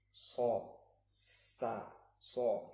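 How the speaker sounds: "So" thick and rounded, "sa" light; demonstrating the ṣad as "So"; ṣad sound demo. Shown as a demonstration. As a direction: contrast the heavy "So" with the thin "sa". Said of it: "so itu berbunyi O".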